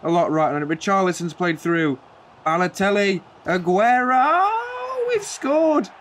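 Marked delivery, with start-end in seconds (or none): 2.5-5.9 Martin Tyler-style screaming goal call, high and drawn out; commentator goal call. Pretend. The fan screams the late equaliser like a TV commentator. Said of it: "Was that Martin Tyler-esque or what?".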